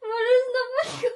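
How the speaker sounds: high, tearful voice; choked up with happy news, voice pitched high; crying as you tell a loved one. Real, not acted. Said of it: "Why she's crying?".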